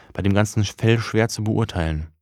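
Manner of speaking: usual speaking voice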